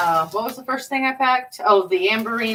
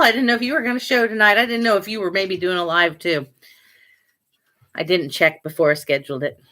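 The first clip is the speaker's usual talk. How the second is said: normal talking voice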